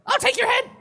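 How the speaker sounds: very high, loud furious screech; shrieked threat. Acted. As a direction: scream the threat. Dryad threatening.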